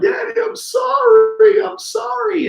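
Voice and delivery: very high, drawn-out tearful apology; imitated sobbing child. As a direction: wail it slowly like a sobbing kid. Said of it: "he's sobbing".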